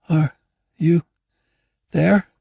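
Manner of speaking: put-on high, halting girl's voice; faltering high-pitched question; low-spoken girl. A narrator's reading. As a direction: ask word by word, as a weak girl in the dark. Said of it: "the girl's voice came low from the blackness".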